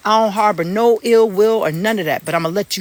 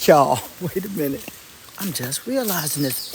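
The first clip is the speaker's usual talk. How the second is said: ordinary delivery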